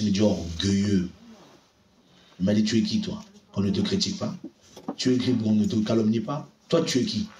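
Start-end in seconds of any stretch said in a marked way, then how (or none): 0.2-1.1 lower, slow stern rebuke; a hint of a stern divine rebuke; authoritative voice of God. God rebuking the preacher, lowered stern voice. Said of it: "une voix autoritaire qui me crie dessus". 2.6-7.3 authoritative voice of God. Retold or imitated. God questioning the proud preacher, low stern voice. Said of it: "une voix autoritaire qui me crie dessus".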